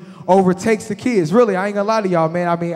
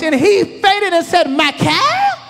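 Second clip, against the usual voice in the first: slow, higher mocking disbelief; mock incredulous; 7 semitones higher, slower than usual. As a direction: mimic the faint in disbelief. Mocking the brother.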